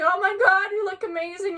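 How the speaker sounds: high, excited mimicked compliment; acted-out delighted squeal; imitated gushing. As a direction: gush like a delighted admirer.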